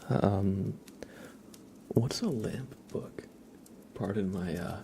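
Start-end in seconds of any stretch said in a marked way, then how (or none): 1.9-3.2 puzzled unvoiced whisper. Real, not acted. Streamer asking chat a question, whispering. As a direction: ask it softly on the breath.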